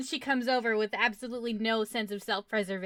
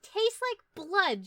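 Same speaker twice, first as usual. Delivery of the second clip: slow, higher-pitched pronouncement; in-character verdict; 7 semitones higher, much slower than usual. Acted. Adventurer reports on licking the coin, slow and high. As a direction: draw it out slowly and high. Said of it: "She's like".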